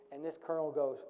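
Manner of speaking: ordinary delivery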